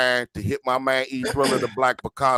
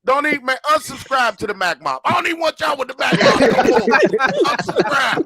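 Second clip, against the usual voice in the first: high, louder playful outburst; mock tantrum; 9 semitones higher, 3 dB louder than usual. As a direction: pretend to storm off. Mock telling fans to leave.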